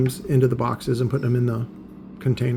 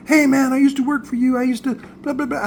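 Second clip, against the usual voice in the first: high-pitched mocking mimicry of a pal; fake chummy; 11 semitones higher than usual. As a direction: imitate someone sucking up like an old buddy, much higher. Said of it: "he starts acting like he's my friend".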